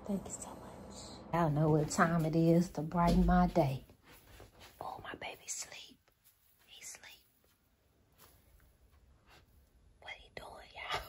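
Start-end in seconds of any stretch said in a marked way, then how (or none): 0.1-1.0 soft whispered thanks. Real, not acted. Whispering thanks so the baby stays asleep. Whispering near her sleeping pet. 4.8-11.0 hushed whisper, barely audible. Real, not acted. Whispering over her sleeping baby Frank.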